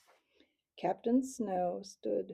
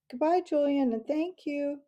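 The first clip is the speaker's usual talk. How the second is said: higher, louder farewell call; 6 semitones higher, 8 dB louder than usual. A narrator's reading. Mouse calls thanks after his departing friend, calling out. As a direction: call after someone walking away. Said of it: "Matthias called after the retreating figure".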